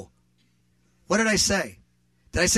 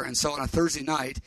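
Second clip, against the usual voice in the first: normal talking voice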